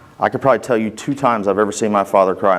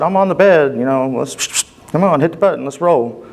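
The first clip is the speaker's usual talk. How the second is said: higher-pitched, insistent retold plea; impatient urging; 6 semitones higher than usual. Patient urging orderly to push the elevator button, retold impatient plea. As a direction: urge him along, pitched up and eager.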